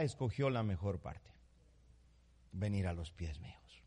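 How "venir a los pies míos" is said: ordinary talk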